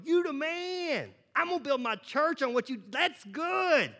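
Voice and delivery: high, slow, gushing praise; exaggerated praise. Pastor voices God praising Peter, high and gushing. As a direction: gush it sky-high and slow. God's affirmation, then rebuke contrast.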